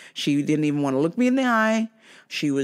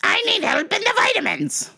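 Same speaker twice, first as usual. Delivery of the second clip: high-pitched mocking customer voice; demanding customer. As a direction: screech it like an entitled shopper.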